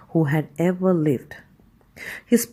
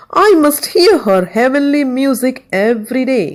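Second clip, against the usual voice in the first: higher and louder eager emperor; emperor cries out; 5 semitones higher, 6 dB louder than usual. A narrator's reading. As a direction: declare it with royal longing. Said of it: "he cried".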